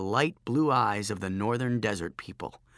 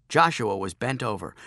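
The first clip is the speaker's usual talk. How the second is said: ordinary delivery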